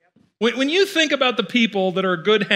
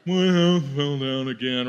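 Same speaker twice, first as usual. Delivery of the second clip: low, slow, mopey donkey voice; lightly voiced glum Eeyore moan; 6 semitones lower, slower than usual. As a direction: droop it like a gloomy Eeyore. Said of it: "that's Eeyore".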